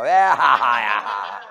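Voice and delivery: big drawn-out "ha-ha" laugh; loud mock laugh. Teacher catching student looking at the Bible, loud laugh. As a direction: laugh loudly at catching someone out.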